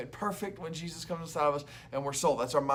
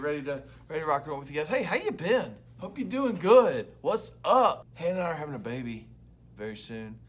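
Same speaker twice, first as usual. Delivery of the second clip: usual speaking voice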